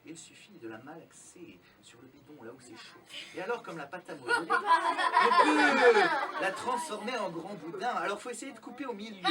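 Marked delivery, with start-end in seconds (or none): 3.3-9.3 loud showman patter; kids party magician. Acted. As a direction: hype the trick to the kids.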